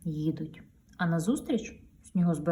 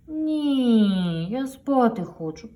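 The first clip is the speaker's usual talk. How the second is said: higher, slowish drowsy no; sleepy refusal; 5 semitones higher, 6 dB louder, much slower than usual. Acted. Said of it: "відмовляється причіп". (сонна відмова)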